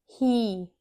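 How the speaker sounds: "ही" with a held ee; long E sound. Shown as a demonstration. Teacher gives an open syllable example, long ee held. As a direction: draw out the vowel of "ही" as a long ee. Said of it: "long sound बनाएगा".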